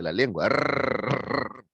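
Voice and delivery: rolled tongue-tip rrr, drawn out slowly; rolled Spanish rrr; Spanish trilled R. Shown as a demonstration. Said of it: "les enseño a pronunciar la R".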